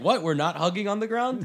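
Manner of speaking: high-pitched, incredulous question; mock bafflement. Fighter to opponent who refuses his hug, mock baffled. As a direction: imitate someone baffled, pitch shooting up.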